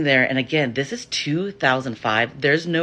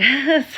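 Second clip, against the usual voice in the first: nervous high laugh; 13 semitones higher, 5 dB louder than usual. Real, not acted. Nervous laugh facing hostile stare.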